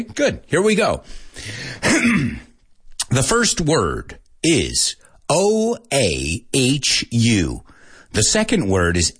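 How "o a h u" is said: slow, letter by letter; each letter drawn out and separate; spelling out. Shown as a demonstration. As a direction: spell it out slowly for students. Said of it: "I'm going to spell some words for you".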